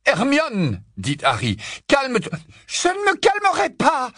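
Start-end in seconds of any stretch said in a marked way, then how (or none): 0.0-0.8 high, urgent shout; rappel à l'ordre. Acted. Harry veut arrêter Hermione. 1.9-2.4 high, raised-voice plea; injonction urgente. Acted. Harry tente de calmer Hermione. 2.7-4.1 very high, screamed refusal; hurlement furieux. Acted. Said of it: "hurla-t-elle".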